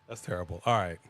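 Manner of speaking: ordinary delivery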